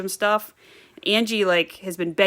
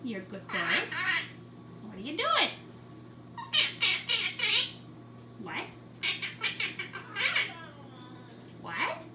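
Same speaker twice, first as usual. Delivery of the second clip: talking to a bird; 8 dB quieter than usual. Real, not acted. High-pitched talk to pet birds.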